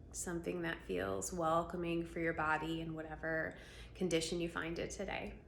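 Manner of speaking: calm voice leading a breathing practice; guided meditation. Acted. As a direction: ease them gently into their body.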